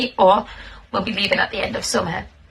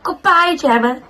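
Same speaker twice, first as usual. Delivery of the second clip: put-on higher, louder goodbye called out like a toddler; child's shout; 7 semitones higher, 3 dB louder than usual. Reading the toddler's card, a child's goodbye shout. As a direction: call it out brightly as the little one leaving. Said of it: "I will shout".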